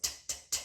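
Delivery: T as soft voiceless clicks of air; unvoiced T. Shown as a demonstration. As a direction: make only the T sound, without any voice. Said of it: "One's unvoiced".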